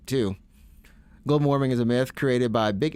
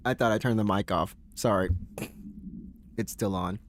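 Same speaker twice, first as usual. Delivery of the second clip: normal talking voice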